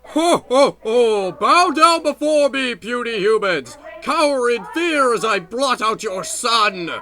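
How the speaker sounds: booming, grandiose villain; booming arrogant alien overlord. Acted. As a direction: lord it over tiny humans. Villain sun taunts humans.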